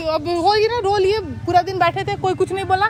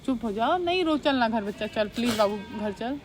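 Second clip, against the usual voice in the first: soft coaxing; 4 semitones lower, 8 dB quieter than usual. Acted. Coaxing crying brother home softly.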